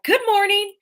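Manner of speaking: high, loud, chirpy hello; bright greeting. Retold or imitated. Walking in on the sleepy gal at seven, loud cheery greeting. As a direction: call it out high and full of morning energy.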